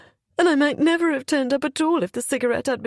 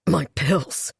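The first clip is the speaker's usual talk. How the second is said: through gritted teeth; 6 semitones lower than usual. Acted. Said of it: "he said through gritted teeth".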